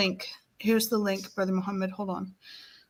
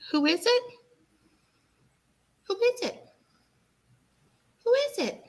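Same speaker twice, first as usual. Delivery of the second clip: high sing-song prompting; teaching a parrot; 14 semitones higher, 4 dB quieter than usual. Real, not acted.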